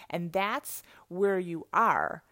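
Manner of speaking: normal talking voice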